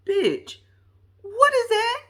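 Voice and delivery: high, loud mock shock; appalled disbelief. Retold shocked reaction to dress.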